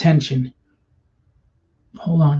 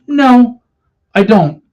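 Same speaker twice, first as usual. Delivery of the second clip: sharp louder higher no; a forceful, higher no; emphatic no; 6 semitones higher, 5 dB louder, slower than usual. Real, not acted. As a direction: cut yourself off firmly. Emphatic self-answer.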